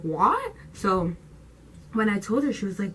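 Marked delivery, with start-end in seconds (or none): 0.0-0.5 higher, rising "What?" imitating mom; mom's "what". Repeating mom's reaction to the catfish news, imitated rising "What?". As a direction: do mom's startled question, pitched up. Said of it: "that's literally how my mom says what".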